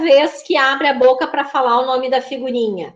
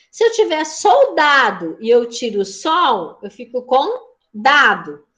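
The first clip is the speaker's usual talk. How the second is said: slow, deliberate teacher demo; slow classroom demo. Shown as a demonstration. Teacher showing syllable removal to the class, slow and deliberate. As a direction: lay out each syllable slowly for the pupils. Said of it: "por exemplo".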